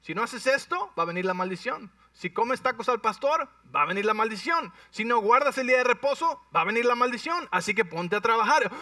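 threatening legalists. Retold or imitated. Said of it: "vinieron los religiosos y les dijeron".

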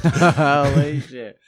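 laughing in slow amazement; drawn-out laughing disbelief. Real, not acted. Reacting to mind-blowing idea.

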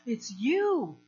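loud swooping exclamation on YOU; retold happy surprise, last word stretched; delighted recognition. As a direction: greet someone you are amazed to see. Retold delighted recognition on meeting again.

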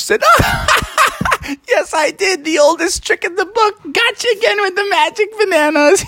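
very high laughing wife; wife laughing at her trick; gleeful cackle. Acted. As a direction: gloat with laughter.

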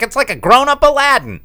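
high, loud mock-gushing reviewer voice. Mocking a trailer review line, high and loud mock-gush. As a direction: squeal it like a gushing trailer quote. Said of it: "one review in the trailer where it's like".

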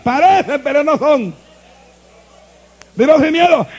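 loud, driving sermon voice; full-force preaching. Acted. Pastor preaching hard to his congregation, full-force preaching. Pastor urging the church to worship.

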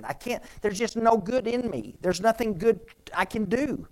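high, loud, pleading protest of unworthiness; despairing man. Retold or imitated. A broken man protesting to Jesus, high and desperate. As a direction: cry out that you are worthless and can't help. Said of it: "what did Jesus say to that man".

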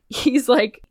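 usual speaking voice

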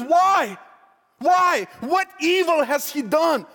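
loud, high-pitched exasperated questioning; disbelieving outcry. Acted. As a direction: demand an answer from the crowd, appalled. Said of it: "he can't believe this".

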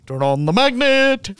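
high, slow hammed-up action hero; mock movie line. Mocking a Fast and Furious musical, high hammy delivery. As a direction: deliver it like a cheesy blockbuster line.

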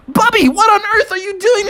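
high-pitched, incredulous cry; alarmed disbelief. Acted. Junior Bear sees Bubby scratching on a tree, alarmed. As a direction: call out in shock at a friend.

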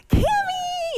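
panicked mom shouting for her lost daughter; nervous high call. Retold or imitated. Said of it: "your voice gets high when you're a little nervous".

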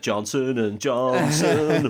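drawn-out, slow ghostly wail; spooky ghosts. Acted. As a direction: stretch the names out like a haunting ghost. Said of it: "'Cause they're ghosts as well".

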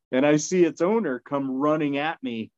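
ordinary delivery

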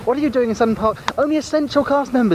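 high squeaky puppet voice; squeaky ventriloquist dummy. Acted. Narrator puppet speaks high.

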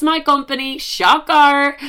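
high, loud mock surprise; sarcastic. Real, not acted. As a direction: hit it high with sarcastic disbelief. Sarcastic comment on Josh.

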